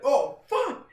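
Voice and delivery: high, loud dismayed outburst; acted alarm. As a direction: relive the sudden dizzy shock. Reliving dizziness on sitting down.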